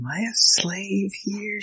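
high, soft, drawn-out mock question; bewildered character. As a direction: ask slowly and timidly high, imitating her confusion. Voicing confused film character's question.

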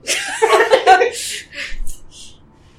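big burst of laughter; loud laugh. Real, not acted. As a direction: crack up laughing. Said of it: "Okay, I'm gonna restart".

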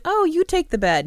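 hammed-up chivalry; mock polite gentleman. Agent offers the girl the bed, mock polite and high. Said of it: "He is like".

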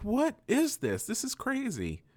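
high, slow squeaky disbelief; mock shocked Derek. Derek squeaking in disbelief at the reveal. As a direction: ham up the bewildered Derek. Derek reacting, retold cartoon scene.

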